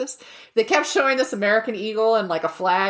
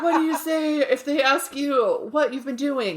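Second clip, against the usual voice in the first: higher, hammed-up quiz voice; ad question; 7 semitones higher than usual. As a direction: ask it like a cheesy ad. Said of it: "and he's like".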